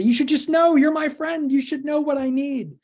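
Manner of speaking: high, loud hurt friend insisting; demanding upset friend. Pretend. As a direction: play the upset friend who expects you to just know what she needs. Friend answers demanding support.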